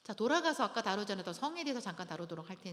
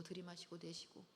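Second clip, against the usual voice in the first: softer breathing cue; 12 dB quieter than usual. Shown as a demonstration. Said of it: "먼저 호흡하세요".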